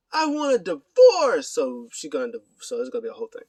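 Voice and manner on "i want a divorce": high, loud, drawn-out demand; hammed-up marital outrage; mock outburst. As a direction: belt it out as an overdramatic spouse. Acting out demand for divorce.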